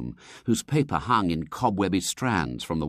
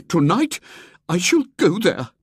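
put-on much higher, louder, resolute old lady; Miss Hatherley; 12 semitones higher, 8 dB louder than usual. Miss Hatherley vowing to the society to go, resolute. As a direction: declare it boldly.

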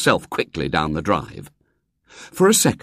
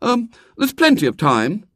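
higher, falsely casual; failed airy manner; 12 semitones higher than usual. A narrator's reading. Boy stalling the little girl, unconvincingly airy. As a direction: try to sound breezy and not manage it. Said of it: "trying rather unsuccessfully to assume an airy manner".

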